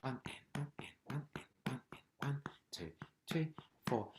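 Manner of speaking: quick whispered counting in. Shown as a demonstration. Teacher counting the class in with a quick whisper. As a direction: whisper the count fast and light. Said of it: "you can just do this hand in between".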